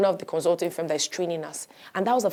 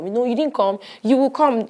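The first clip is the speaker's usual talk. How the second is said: high, louder, pushing the point; mock insistence, raised and high; insistent raised voice; 6 semitones higher, 7 dB louder than usual. HR caller insisting she come in, raised and high.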